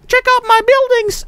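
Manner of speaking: put-on very high, bragging kid voice; excited little Johnny. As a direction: show off like an eight-year-old in a squeaky pitch. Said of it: "like, eight-year-old little Johnny".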